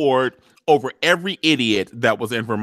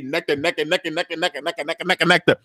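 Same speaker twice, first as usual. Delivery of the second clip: rapid, softer, rhythmic repetition; playful sprinkling chant; 3 dB quieter, faster than usual. Acted. Recapper miming Olivia showering nectar on everyone, playful rapid chant. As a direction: rattle it off like spraying it over everyone. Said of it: "Olivia was like".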